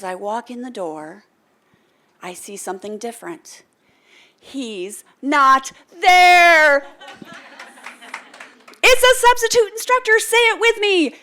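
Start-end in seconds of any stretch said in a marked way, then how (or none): 4.4-6.9 slow, loud, higher reveal; dramatic reveal. Retold or imitated. Walking in to find him gone, a loud slow reveal. Surprise at empty classroom. 8.8-11.1 much higher and louder, bursting with glee; excited. Real, not acted. Telling the audience, high loud excitement.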